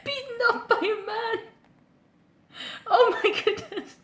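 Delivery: high, squeaky, helpless laughter; breaking up with laughter, barely getting words out; laughing hard. Real, not acted. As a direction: talk through a real laughing fit. Cracking up at depressing ending.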